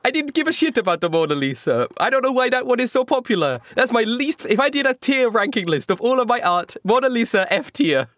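put-on high, hammy Italian accent; dismissive artist in a bad Italian accent. Leonardo ranks his own art.

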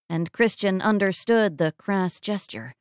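plain reading voice

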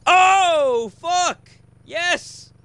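high excited shout of triumph; excited yelling. Real, not acted. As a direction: yell out, thrilled and loud. Sudden kill in the game.

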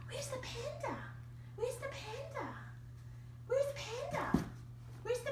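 hushed, breathy whispered question; whispering. Real, not acted. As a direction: whisper it quickly to the dog. Owner whispers cue to dog.

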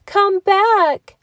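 put-on high, loud old woman's call. A narrator's reading. Old woman shouts after the runaway, high and loud. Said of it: "said the little old woman".

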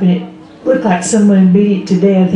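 ordinary talk

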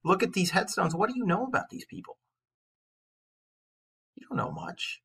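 usual speaking voice

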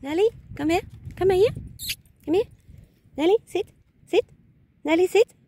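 high, loud commands to dog. Real, not acted. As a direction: call the dog firmly.

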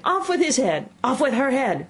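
high-pitched queenly shouts; ringing royal commands, pitched well up; furious shouting. A narrator's reading. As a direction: shout each sentence in a furious passion.

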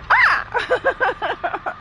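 high, abrupt mock bark; sudden high doggy yelp; imitated dog bark. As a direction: mimic the mad dog, barely holding laughter. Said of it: "He's like".

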